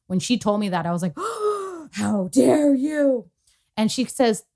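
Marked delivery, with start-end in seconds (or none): 1.2-1.9 high, hammed-up gasp; shocked gasp. Acts shock at therapist's words. 1.9-3.3 slow, drawn-out indignation; mock outrage. Acts outrage at therapist's words.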